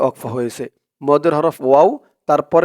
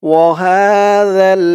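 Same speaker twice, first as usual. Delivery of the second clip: Quran recitation; 3 semitones higher, 5 dB louder, much slower than usual. Shown as a demonstration. Teacher reciting for viewers to repeat, slow tajweed chant. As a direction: recite it slowly so students can follow. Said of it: "recitation demo".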